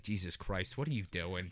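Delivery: low, very soft muttered disbelief; hushed dismay. Retold or imitated. Kids looking at him, imitated hushed dismay. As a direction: mutter it under your breath, imitating appalled onlookers.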